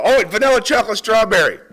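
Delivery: loud, high eureka shout; figured it out, loud and thrilled; excited. Real, not acted. Figures out the joke.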